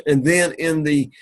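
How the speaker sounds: usual speaking voice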